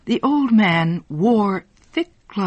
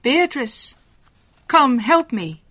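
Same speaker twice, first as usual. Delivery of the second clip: raised, higher-pitched call to someone; mildly projected call for help, pitched up; 7 semitones higher than usual. A narrator's reading. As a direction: call out to summon someone from indoors. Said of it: "and called loudly".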